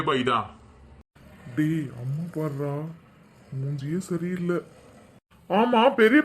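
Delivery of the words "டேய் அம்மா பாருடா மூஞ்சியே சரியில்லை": slow, softer undertone; hushed aside. Acted. Son warning his brother about mother's mood, hushed aside. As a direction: keep it down so mother won't hear.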